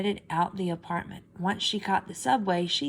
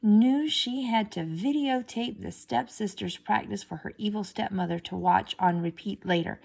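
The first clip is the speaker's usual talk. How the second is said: ordinary delivery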